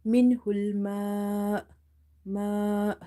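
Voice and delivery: "Minhul ma" slow, with "ma" held long and cut short by hamza; drawn-out "ma" ending in a clean glottal stop; stretch and sudden stop. Shown as a demonstration. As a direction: hold "ma" at length, then close the throat abruptly. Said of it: "sudden pause in order to pronounce hamza".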